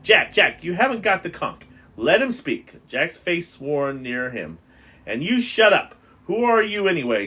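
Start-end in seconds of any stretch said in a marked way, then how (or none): 0.0-2.9 raised, louder call over the crowd; urgent protest. Acted. Ralph calls across the assembly to stop Jack, raised and urgent. 5.1-7.2 put-on high, loud snap at the fat boy; angry retort. Jack turns on Piggy at the assembly, loud and angry.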